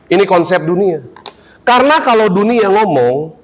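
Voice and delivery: ordinary talk, nothing marked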